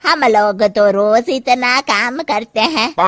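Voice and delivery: loud, slow taunting; mock her softness with disdain; scornful mother-in-law. Mother-in-law stops Janki scornfully. (तिरस्कार भरी सास की आवाज़)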